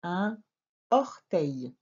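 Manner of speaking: "Un orteil" with a pause after "Un"; without liaison. Shown as a demonstration. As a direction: keep "Un" and "orteil" apart, no N between them. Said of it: "on ferait la liaison".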